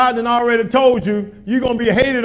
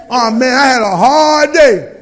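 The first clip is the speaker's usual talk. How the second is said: slow hammed-up weary shout; mock complaint shouted; 2 semitones higher, 11 dB louder than usual. Pastor imitating a tired churchgoer, hammed-up loud moan.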